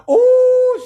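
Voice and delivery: sudden high loud ooh; a big shocked ooh at the sight of her; comic shocked ooh; exaggerated ooh. Real, not acted. Reacting to officer's look.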